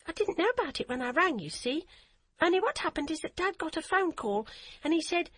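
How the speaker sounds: put-on high, bubbly voice of a happy eight-year-old; a child who can't stop repeating her news; excited little girl. A narrator's reading. Child voice of Hadia.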